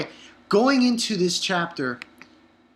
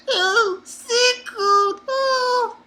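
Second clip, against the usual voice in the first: high, hammed-up whining cry; mock crying; 3 dB louder, much slower than usual. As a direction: wail it like a crybaby cat. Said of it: "and he's crying".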